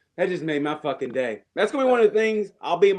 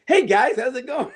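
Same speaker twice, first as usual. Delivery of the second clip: high, loud nervous hello; overly bright greeting to hide fear; nervous comic greeting a tough crowd; nervous chipper greeting; 8 semitones higher, 5 dB louder than usual. Retold or imitated. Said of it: "I'd come out there like".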